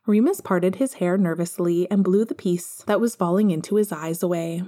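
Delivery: ordinary delivery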